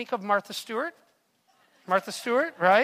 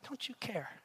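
hushed repeat; 13 dB quieter than usual. Shown as a demonstration. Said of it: "imagine saying that to Jesus".

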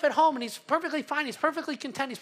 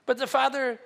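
ordinary talk

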